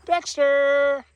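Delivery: high, loud call. Real, not acted. Owner calling Dexter loudly from across the yard. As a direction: belt the name out high.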